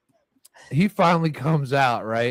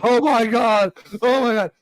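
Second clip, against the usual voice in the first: high, loud shocked outcry; squealing oh my God at the car smell; horrified oh my God; 9 semitones higher, 5 dB louder than usual. Retold or imitated. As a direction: recoil at the stench.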